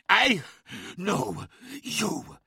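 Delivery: forced out in pieces, fighting to speak; strained hiss. Acted. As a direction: hiss each word through the struggle. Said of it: "hissed Skarsen, fighting for every word".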